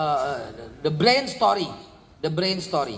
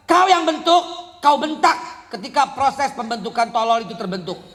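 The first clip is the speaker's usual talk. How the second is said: loud, high rebuke to parents; forceful preaching; 5 semitones higher, 4 dB louder than usual. Real, not acted. Preacher scolding parents in the crowd, loud and high. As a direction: hammer the point home to the congregation.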